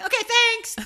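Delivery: loud, high-pitched chirpy thanks; too friendly. Retold or imitated. Driver thanking the family blocking her, high and over-friendly. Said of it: "that's even sounded too friendly".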